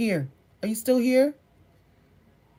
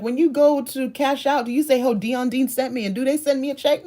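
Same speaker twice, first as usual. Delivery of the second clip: mock indignant; 6 semitones higher, 4 dB louder than usual. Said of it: "I just got pissed off".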